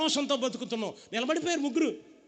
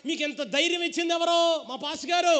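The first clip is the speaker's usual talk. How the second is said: mocking king; 6 semitones higher than usual. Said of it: "రాజుగారు అంటాడు".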